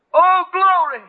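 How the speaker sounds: very high, loud shout of joy; overjoyed cry of glory; ecstatic cry. Retold or imitated. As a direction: fling it up to heaven. Answering the voice, overjoyed.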